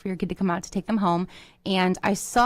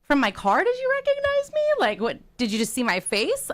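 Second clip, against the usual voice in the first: bewildered question; 7 semitones higher than usual. Retold or imitated. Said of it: "I'm like".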